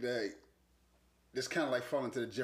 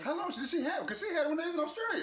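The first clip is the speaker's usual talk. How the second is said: high-pitched, amused disbelief; incredulous question. Real, not acted. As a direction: ask in a much higher voice, half laughing. Incredulous reaction to covid news.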